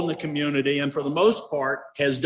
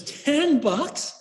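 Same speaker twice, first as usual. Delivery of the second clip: high, rising question of disbelief; incredulous; 12 semitones higher than usual. Acted. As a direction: echo the price in acted astonishment. Buyer repeats price in disbelief.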